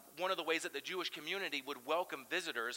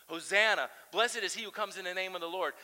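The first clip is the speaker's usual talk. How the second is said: a louder cry of "Hosanna"; yelling crowd; 3 semitones higher, 7 dB louder than usual. Retold or imitated. One side of the road calling to the other, mildly loud. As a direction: call it out like a crowd. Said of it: "and they are yelling".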